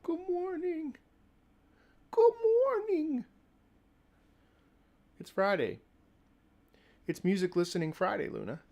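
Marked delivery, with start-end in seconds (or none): 0.0-3.2 very high, drawn-out sing-song; singsong greeting. Acted. Streamer greeting chat in a high singsong. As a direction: croon good morning high and slow.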